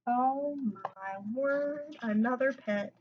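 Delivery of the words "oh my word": ordinary delivery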